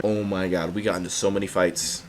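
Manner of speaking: ordinary talk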